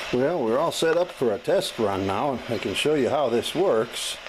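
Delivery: ordinary delivery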